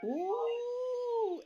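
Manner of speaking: squealing high ooh; high flirty coo. Acted. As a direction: coo like a smitten lover.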